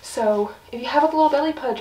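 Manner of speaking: ordinary delivery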